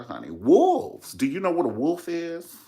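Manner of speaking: ordinary delivery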